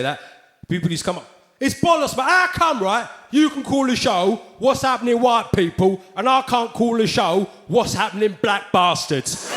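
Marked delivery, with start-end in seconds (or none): 1.6-9.5 mock indignant objection, starting high.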